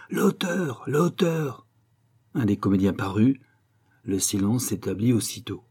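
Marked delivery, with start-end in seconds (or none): 0.1-1.7 appel aigu de foule enthousiaste; réclamer l'auteur en criant; acclamation. Acted. (la salle électrisée réclame l'auteur, cris aigus)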